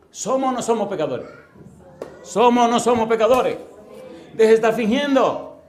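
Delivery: loud, high, insistent preaching; forceful challenge. Real, not acted. Preacher confronting the congregation about sin, loud and insistent. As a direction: drive the question home, building to a shout.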